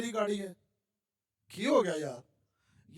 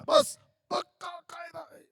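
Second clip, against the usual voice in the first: a high, loud, slow shout of the rule; emphatic shout; 10 semitones higher, 4 dB louder than usual. Real, not acted. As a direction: drive the rule home hard. Teacher stressing a rule.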